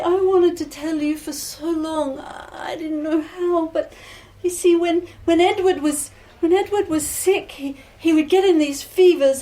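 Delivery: high, halting, tearful voice; distressed bride confessing. Acted. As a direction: confess haltingly, close to tears.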